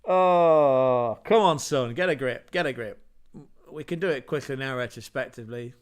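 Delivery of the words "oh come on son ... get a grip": loud, jokey telling-off of himself; mock self-scolding. Real, not acted. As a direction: scold yourself like a coach, half-laughing. Scolding himself for forgetting lineups.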